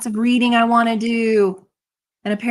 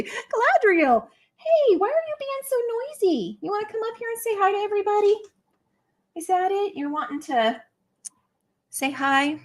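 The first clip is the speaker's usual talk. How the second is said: much higher sing-song coo to a pet; 11 semitones higher than usual. Real, not acted. To her noisy pet in the background, higher cooing baby talk.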